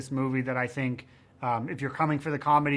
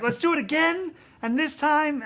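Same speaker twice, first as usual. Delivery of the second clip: high-pitched, excited mock plea; imitating himself begging keenly for another go; over-eager retake request; 13 semitones higher, 4 dB louder than usual. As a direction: act out giddy insistence, voice pitched way up. Acting out his eager plea to producers.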